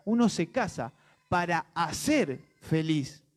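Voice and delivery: hacer drawn out in a slow, careful repeat; measured quote with long, weighty "hacer"; slow, deliberate. Retold or imitated. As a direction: say it slowly, stretching "para hacer" apart from "feliz". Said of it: "más detenidamente".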